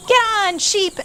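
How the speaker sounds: high call urging Sheep on; shouted call. A narrator's reading. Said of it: "everyone shouted".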